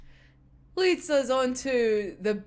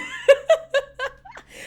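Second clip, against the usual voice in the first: high burst of laughter; 5 dB louder than usual. Real, not acted. Fan squealing with laughter at the anime scene.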